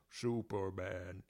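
low, slow giant robot voice. Retold or imitated. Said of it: "And in his robot voice".

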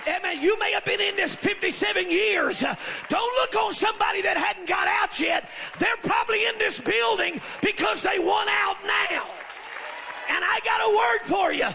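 loud, high, shouted preaching. Real, not acted. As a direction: preach it at full force. Preaching at full force.

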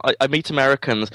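usual speaking voice

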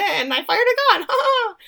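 imitating a high, silly laugh; giddy confession. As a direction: confess it giddily, voice shooting up into "ha ha". Retold giddy confession with fake laugh.

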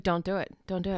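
low, hushed imitation of a husband; quiet warning. Husband telling wife not to step in, quiet warning. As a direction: warn softly, keeping it under the breath.

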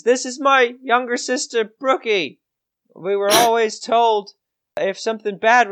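put-on high-pitched young lad explaining himself; earnest farm boy. Farm boy explaining why he hid with his sister, high and earnest.

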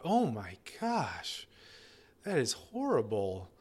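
slow, hushed whisper of dismay; a slow whispered oh my gosh, full of sympathy. Shown as a demonstration. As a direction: breathe it out softly, aghast for them. Therapist voicing shocked empathy.

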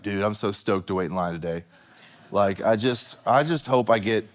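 low, deadpan "stoked" dude; mock enthusiasm. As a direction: play the eager queuer with no excitement at all. Mocking imagined eager Disney queuer.

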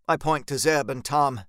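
plain reading voice

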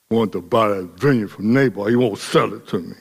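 low, pouting mumble; sulky Ahab. Retold or imitated. Ahab sulking to his wife about the vineyard, low pout. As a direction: sulk like a spoiled king.

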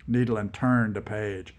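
usual speaking voice

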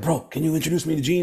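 high, fast eager ask; eager musician friend. Retold or imitated. Musician friend begging to meet Gene, high and rushed. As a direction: rush it out like a starstruck fan. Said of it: "he was like".

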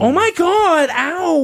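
high, loud whiny wimp; hammed-up cry of pain; mock wailing. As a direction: wail like you've been badly hurt. Mock wail after being hit.

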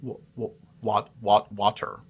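water, haltingly restarted on "wa"; testing pronunciation. Shown as a demonstration. Host testing how to say it, "water" in halting attempts. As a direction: feel out the first syllable of "water" in stuttered tries.

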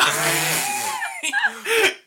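very high shrieking laughter. Real, not acted. GM laughing at his own twin joke, shrieking. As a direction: laugh so hard it goes to a squeal.

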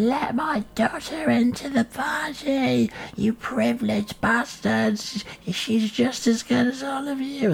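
high, slow, far-off wailing mum; drunk mum yelling. Retold or imitated. Mum screaming at the rich party hosts, high and slow. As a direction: yell through the gate, drunk. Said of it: "she was at the gate screaming".

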